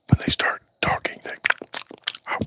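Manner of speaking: unvoiced whisper continuing the ASMR bit. Shown as a demonstration. ASMR whisper to livestream viewers. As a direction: keep it hushed like an ASMR host. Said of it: "like A S M R".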